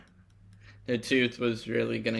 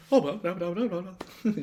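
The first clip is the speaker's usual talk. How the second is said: dismissive babble, pitched up; mocking blah-blah; 6 semitones higher than usual. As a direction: rattle it off as mock chatter. Mocking a nagging reply.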